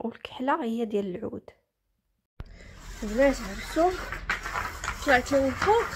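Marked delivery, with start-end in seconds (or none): 3.0-5.9 out of breath. Real, not acted. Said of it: "عييت نيت".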